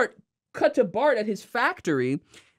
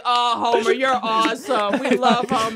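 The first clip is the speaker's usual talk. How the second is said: mock mourners; 3 semitones higher, 3 dB louder than usual. Retelling the funeral, mock adoring crowd. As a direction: gush like fawning mourners. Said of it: "Everyone at the funeral is like".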